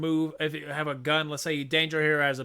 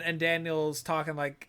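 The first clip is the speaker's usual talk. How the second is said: ordinary talk, nothing marked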